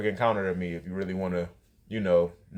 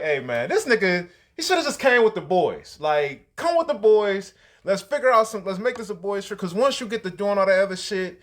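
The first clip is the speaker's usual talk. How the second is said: high, loud retold rant; worked-up complaint; 9 semitones higher, 4 dB louder than usual. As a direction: vent it high and emphatic, imitating the moment. Retelling own reaction to friend's spazzing.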